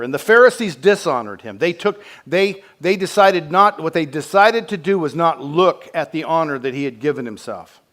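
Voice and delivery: loud, high impassioned preaching; fervent pulpit cry; full-force preaching. Acted. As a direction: preach it out with rising fire.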